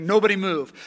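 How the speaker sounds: loud, hard command at gunpoint; barked order. Retold or imitated. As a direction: freeze them with one order. Soldier holds family at gunpoint.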